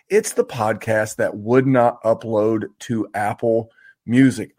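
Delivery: ordinary talk, nothing marked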